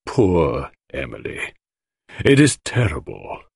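slow, low start, subdued grief; mournful murmur. A narrator's reading. Widower mourns his poisoned wife, sad murmur. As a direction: lament her in an understated murmur. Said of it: "he murmured".

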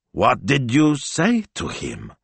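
ordinary delivery, nothing marked

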